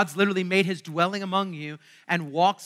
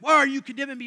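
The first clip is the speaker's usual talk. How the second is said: mock offended; 6 semitones higher, 6 dB louder, faster than usual. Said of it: "they're like".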